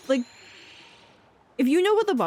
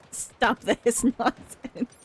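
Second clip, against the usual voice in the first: laughing; much slower than usual. Real, not acted. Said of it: "And it's just like".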